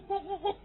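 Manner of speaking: high imitated monkey calls; monkey chatter. The monkey runs off making high chattering calls.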